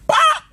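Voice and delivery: loud mock gunshot; gunshot pop. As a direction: snap out a sharp pop.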